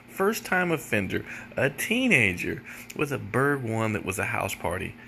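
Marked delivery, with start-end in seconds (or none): none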